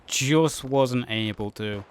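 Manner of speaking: energetic match commentary; live play-by-play. Real, not acted. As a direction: call the action as it happens.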